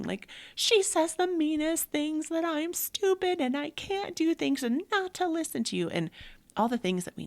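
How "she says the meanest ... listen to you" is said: imitating crying daughter. Mother imitating sobbing teenage daughter, high wailing. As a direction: act out her sobbing words. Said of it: "breaking down, bawling".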